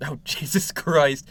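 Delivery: slow, laughing exclamation; laughing disbelief. Real, not acted. Game master reacting to player's giant height, laughing disbelief.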